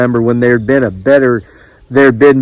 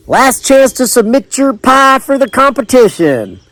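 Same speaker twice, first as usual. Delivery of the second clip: high, sustained announcing call; distant caller's yell; 11 semitones higher than usual. A narrator's reading. Pie contest caller summoning last entries, high yell. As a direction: call it out high across the tables. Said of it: "another caller yelled from a few tables away".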